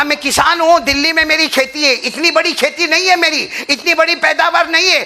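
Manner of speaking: high, loud impassioned preaching; fiery Urdu sermon storytelling; preaching at full force. Acted. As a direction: drive the story home at full cry. Farmer's complaint in story.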